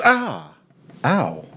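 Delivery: a god yelping when tased; playful cry of pain; mock pain yelp. Fitander seizes up from taser.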